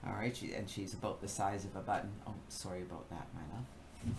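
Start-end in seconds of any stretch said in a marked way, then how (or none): 2.3-4.2 softer, quick apology off-mic; apologizing to her husband, hushed and quick; quiet aside. Real, not acted. Aside to husband Dawn downstairs.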